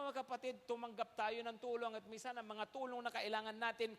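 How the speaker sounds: ordinary delivery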